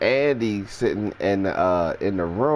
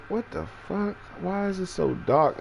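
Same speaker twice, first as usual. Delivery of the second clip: high, hushed confusion; a high, soft mutter of disbelief; baffled; 10 semitones higher, 5 dB quieter than usual. Retold or imitated. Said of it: "I'm sitting there like".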